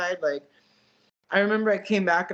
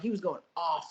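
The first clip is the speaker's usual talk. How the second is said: ordinary delivery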